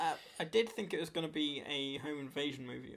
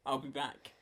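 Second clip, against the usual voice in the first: Terminator line; 3 semitones lower than usual. Retold or imitated. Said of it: "Coming straight off Terminator".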